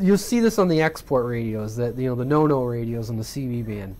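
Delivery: normal talking voice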